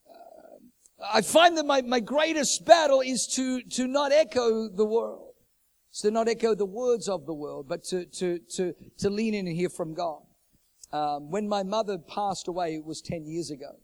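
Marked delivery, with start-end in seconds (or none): 1.1-9.6 full-voiced sermon climax; preaching at full force. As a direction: thunder it out to the church. Preaching climax, loud and slow.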